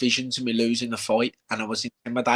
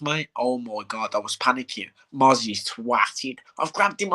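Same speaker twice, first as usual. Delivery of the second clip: usual speaking voice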